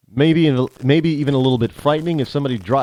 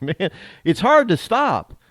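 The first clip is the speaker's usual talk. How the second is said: higher, slow Southern drawl; 7 semitones higher, slower than usual. Retold or imitated. Man telling coworkers he cannot quit, Southern drawl. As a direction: draw it out in an imitated Southern accent. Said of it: "You're talking in a Southern drawl".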